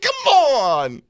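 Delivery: high exasperated groan, then a fed-up push; mock exasperated grunt. Sitting in the opera, mock exasperated. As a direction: groan at the stage in disbelief. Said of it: "I sat there, I'm like".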